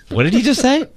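imagined stunned reaction. Retold or imitated. Host voicing the people who hired him, mock stunned. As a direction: lift it into a baffled question. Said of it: "do you think they sat there".

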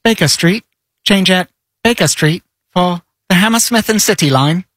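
automated train announcement voice; recorded Tube announcer; London Tube announcer. Acted. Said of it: "my best impression ever is the automated voice from the London Tube".

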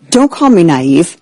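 ordinary delivery

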